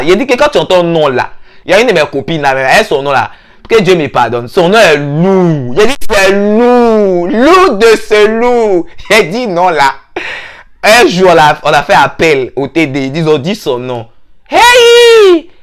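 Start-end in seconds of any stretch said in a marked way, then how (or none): 4.5-8.9 "lourd" said slow and weighty; slow emphasis. Real, not acted. Teller stressing that a friend's name is heavy, slow. 14.5-15.5 loud high hey; mock shout. Teller reacting as the heavy name is called, loud.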